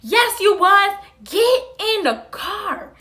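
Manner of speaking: loud, high shout, drawn out at the end; angry mom ordering. Retold or imitated. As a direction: imitate a furious mother's command. Mom orders her into car, retold.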